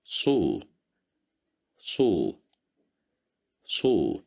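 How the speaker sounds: صو said three times, emphatic s with rounded u; صو repeated as a model; syllable drill. Shown as a demonstration. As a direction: drill صو for learners. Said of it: "dhamma at the top".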